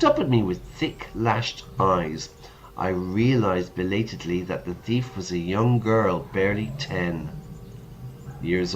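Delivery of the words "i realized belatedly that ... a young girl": usual speaking voice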